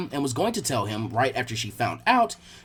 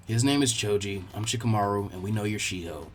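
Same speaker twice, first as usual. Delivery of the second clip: put-on much lower, softer bored boy's voice; low, laid-back teen ninja; lazy Shikamaru; 8 semitones lower, 5 dB quieter than usual. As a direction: drop low and sound unbothered.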